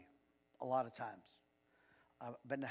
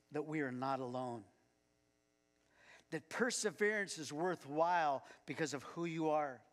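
louder earnest prayer voice; 4 dB louder than usual. Acted. Pastor leading the congregation in prayer, louder. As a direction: address God fervently, louder.

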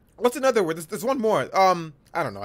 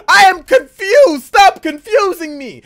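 high, loud frustrated yell; mock confused outburst; 11 semitones higher, 12 dB louder than usual. Real, not acted. Streamer teased by chat, loud mock frustration. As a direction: throw up your hands and shout it. Flustered by chat over pronunciation.

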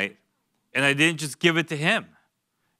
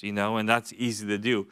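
ordinary talk

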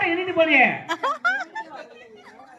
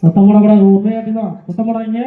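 loud, low crowd-control order; forceful, low-pitched command; calling for silence; 6 semitones lower, 5 dB louder than usual. Real, not acted. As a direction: tell the crowd off loudly. Hushing spectators during the game.

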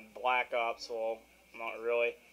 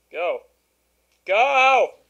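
high, much louder yelling go; urgent shouting; 11 semitones higher, 17 dB louder than usual. Real, not acted. Gamer shouting at his stalled satellite box. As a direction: urge the frozen screen on at full volume. Urging frozen game to load.

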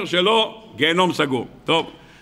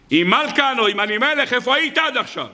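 raised, much higher-pitched challenge; retold divine rebuke; 6 semitones higher than usual. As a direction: imitate God calling someone to account, pitched high. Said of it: "הקדוש ברוך הוא צועק עליי".

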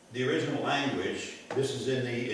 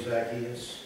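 ordinary delivery

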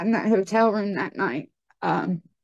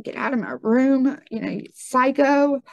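higher-pitched, indignant outburst; retold angry cussing; 6 semitones higher than usual. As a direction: re-enact throwing him out in anger. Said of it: "I began to cuss him".

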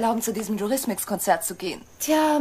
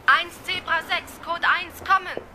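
dispatcher voice over the radio; 7 semitones higher than usual. Acted. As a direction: read out the code over the radio.

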